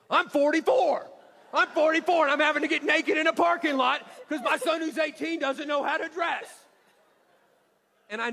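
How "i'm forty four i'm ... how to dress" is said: high, loud tantrum shouting; exasperated meltdown. Retold or imitated. Dad yelling at son while stripping in parking lot, exasperated meltdown. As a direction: re-enact a grown man losing it like a toddler.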